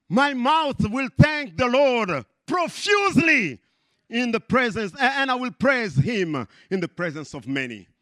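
declaiming scripture. Acted. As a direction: proclaim it to the hall. Preacher reads favorite scripture aloud.

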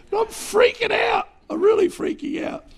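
high, squeaky mock panic. Preacher hamming up his fear of the dog.

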